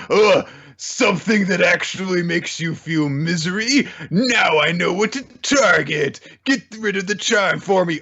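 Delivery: high, sneering bully voice; mock him cruelly; taunting bully.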